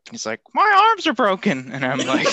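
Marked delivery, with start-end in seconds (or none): 0.5-1.7 put-on high, loud kid complaint; mock a child announcing an injury, pitched way up; imitated little kid. Little kid at the pool telling the lifeguard, imitated high voice. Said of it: "little chubby kid".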